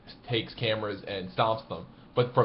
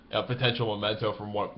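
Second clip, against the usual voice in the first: ordinary talk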